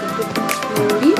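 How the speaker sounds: ordinary delivery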